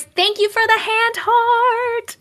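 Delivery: excited squeal. Real, not acted. Squealed thanks for gift.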